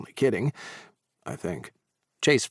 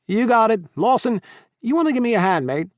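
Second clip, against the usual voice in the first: loud high broad Australian; cheerful broad Australian call; Australian accent; 12 semitones higher, 5 dB louder than usual. A narrator's reading. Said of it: "his broad Australian accent".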